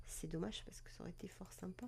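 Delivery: whispered aside. Real, not acted. Wistful aside on dropped legend.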